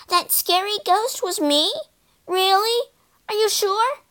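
high, amazed questioning; string out high, astonished questions; incredulous little ghost, pitched high; babbling disbelief. A narrator's reading. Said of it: "it babbled".

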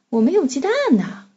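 normal talking voice

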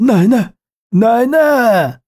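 high loud slow cry for grandma; desperate cry. Acted. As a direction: call out in panic. Child cries out to grandma.

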